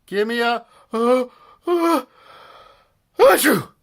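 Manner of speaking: high cheer building into a loud sneeze; the uh-uh windup, then a big sneeze; sneezing cheer. Acted. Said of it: "sneezed the cheerleaders".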